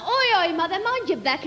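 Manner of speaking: ordinary talk, nothing marked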